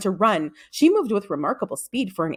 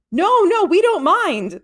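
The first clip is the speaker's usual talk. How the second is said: higher, brighter-pitched teacher voice; Miss Taylor's voice; 11 semitones higher, 5 dB louder, slower than usual. A narrator's reading. Teacher reassuring the worried father, higher voice. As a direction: voice the eager teacher, pitched well up.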